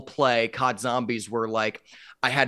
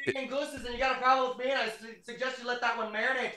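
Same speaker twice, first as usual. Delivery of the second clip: higher, softer hammed-up Canadian accent; menacing Canadian goose, played for laughs; mock Canadian; 11 semitones higher, 8 dB quieter, much faster than usual. Mock Canadian tough-guy bit.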